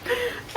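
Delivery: short high laugh; helpless little giggle; embarrassed laugh. Real, not acted. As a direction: burst out laughing at your own accent. Laughing at own English accent.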